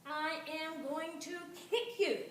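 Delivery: slow, loud, furious threat; stuck fairy threatening to kick the doll in fury; angry. A narrator's reading. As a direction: spit the threat out slowly. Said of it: "She was so angry that she said".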